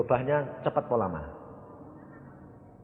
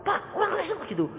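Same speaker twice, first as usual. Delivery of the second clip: quick, high-pitched report; mock tattling complaint; faster than usual. Church member reports bad Christians to pastor, high quick complaint. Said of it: "lalu saya dilapori".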